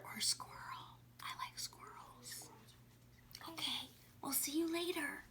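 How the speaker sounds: soft whispered goodbye. Real, not acted. Teacher signs off to the viewers, whispering. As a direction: hush the sign-off. Hushed sign-off.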